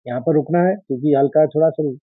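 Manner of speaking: ordinary talk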